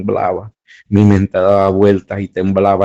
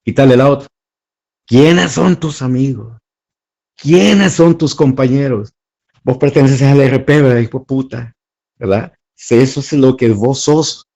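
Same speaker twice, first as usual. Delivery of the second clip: retold interrogator yelling; 5 semitones higher than usual. Torturer interrogating captive.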